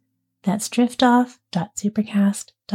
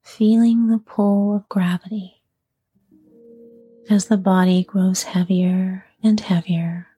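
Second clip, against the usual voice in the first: unhurried yoga nidra voice, letting each phrase settle; guided sleep meditation. Acted. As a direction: ease a listener toward sleep, phrase by phrase. Leads guided sleep relaxation.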